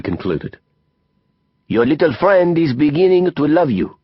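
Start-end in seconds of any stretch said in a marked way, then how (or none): 1.7-4.0 put-on higher French-accented character voice; theatrical Frenchman, knowingly declaring; Didier, worldly French friend. A narrator's reading.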